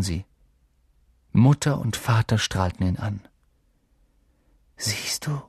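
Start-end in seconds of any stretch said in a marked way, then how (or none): 4.8-5.4 soft, breath-only whisper; hushed, secretive question. A narrator's reading. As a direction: whisper it expectantly. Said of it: "flüsterte Harry".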